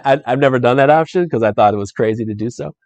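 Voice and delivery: normal talking voice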